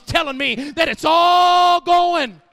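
rising sermon build to a loud cry; full-force preaching. Acted. Someone recognizing a holy accent, preached at rising full force. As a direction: build steadily into a long, loud held word.

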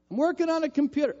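quick, high, put-upon complaint; impatient protest. Retold or imitated. Husband upstairs protesting to his wife, quick and put-upon. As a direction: make it clear he's busy.

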